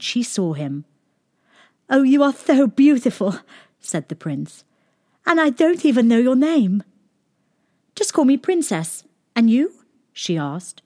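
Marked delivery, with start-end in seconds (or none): 1.9-3.4 higher, louder lovestruck prince. Acted. Prince character voice. 5.2-6.8 prince voice. Acted. Prince character voice. 7.9-9.7 higher, coy princess voice; cartoon princess. Princess character voice.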